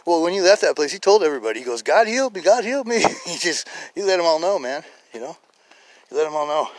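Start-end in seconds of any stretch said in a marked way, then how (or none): 1.8-3.0 high, loud joyful announcing; elated proclamation. Retold or imitated. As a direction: proclaim it to everybody as the healed man. Healed man telling everybody.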